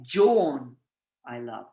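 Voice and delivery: emphatic word order demo, "John" first; emphatic fronted object. Shown as a demonstration. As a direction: lift "John" and let "I love" fall away. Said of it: "But it would be very emphatic".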